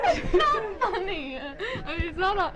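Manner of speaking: high, slow, giggling through the line; breaking into laughter. Real, not acted. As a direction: try to stay in character but crack up. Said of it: "Guys, don't laugh".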